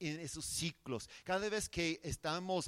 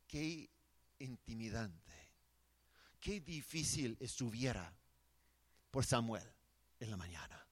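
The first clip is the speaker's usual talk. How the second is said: hushed awe; 6 semitones lower, 6 dB quieter than usual. Real, not acted. Pictures Samuel's fearful morning.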